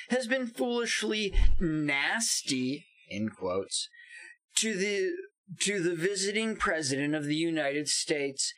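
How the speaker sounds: mock-presidential read-out with swooping pitch; dramatic tweet reading. Podcaster reading favorite Trump tweets aloud, theatrical declaiming.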